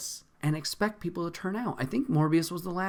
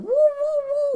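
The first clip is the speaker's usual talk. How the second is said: high, loud mocking woo of a spell; mock magic woo; 7 dB louder than usual. Mocking Doctor Strange's spell with a high woo. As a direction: squeal it out like a wizard casting. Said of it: "Doctor Strange going woo".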